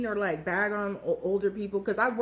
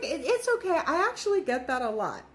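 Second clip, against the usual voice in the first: put-on gentle, higher, kindly old-lady voice; old dancer kindly brushing off the laughter, sweet; 5 semitones higher than usual. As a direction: reassure the girls sweetly, like a grandma. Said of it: "this lady was so sweet".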